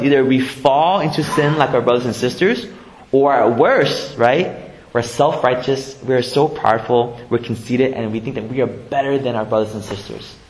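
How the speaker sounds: usual speaking voice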